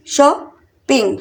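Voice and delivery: Shopping slowly in two separate beats; split into syllables. Shown as a demonstration. Teacher counting out two syllables for learners, syllable by syllable. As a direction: pause between शॉ and ping. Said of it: "shopping में भी टू syllable".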